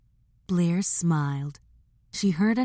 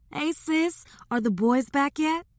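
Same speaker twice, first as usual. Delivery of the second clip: sister's voice; 10 semitones higher than usual. Acted. Voicing sister on phone.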